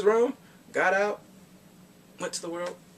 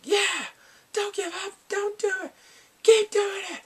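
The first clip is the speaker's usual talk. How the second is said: much higher, louder nerdy cheering; high geek voice; 6 dB louder than usual. Retold or imitated. Retelling the geek cheering on the hero, in a high squeaky voice. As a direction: squeak out encouragement like an excited geek.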